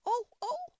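high-pitched cries of pain; screaming. A narrator's reading. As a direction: shriek as the queen. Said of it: "Her screams were so exactly like the whistle".

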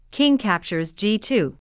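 ordinary delivery

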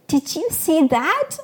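high, loud, drawn-out delight; amazed exclamation. A narrator's reading. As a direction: exclaim it slowly in thrilled amazement. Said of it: "I exclaimed".

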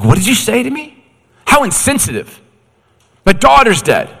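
high, loud, stunned protest; outraged father. Retold or imitated. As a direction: lash back at Jesus in disbelief. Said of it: "tempted to throw a right hook".

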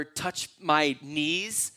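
drawn out very slowly; slow hypothetical. Shown as a demonstration. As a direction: linger on each word as a question. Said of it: "couldn't he have said".

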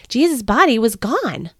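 ordinary delivery

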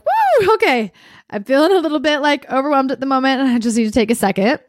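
high-pitched airy "woo", then quick explaining; retold flustered need for a breather; overwhelmed. Retelling stepping back overwhelmed.

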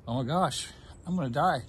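fighting tears through the words; choked up. Real, not acted. Cancer survivor retelling the bad lab results, choked up. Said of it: "sorry, I'm getting choked up".

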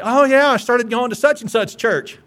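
imitated chipper update to a friend; breezy reply. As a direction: toss it off like cheerful news. Voices a casual churchgoer's reply.

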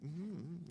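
soft wordless hum; humming. Retold or imitated. Student humming at classmates over a silent meal, humming. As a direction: hum instead of talking. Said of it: "you know, kinda humming".

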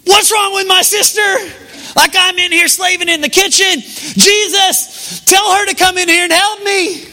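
frazzled Martha. Retold or imitated. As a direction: squeal it out like the overworked sister. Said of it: "And Martha looks over there and goes".

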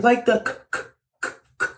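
stuck on a repeated "k" sound; halting "k, k, k", as if the word won't come out; stuttered false start. Retold or imitated. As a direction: imitate stumbling on "k", never finishing the word. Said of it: "sounded like he started to say country".

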